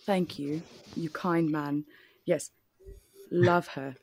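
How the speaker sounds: put-on British accent. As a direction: play at sounding British. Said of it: "rest of the podcast in British".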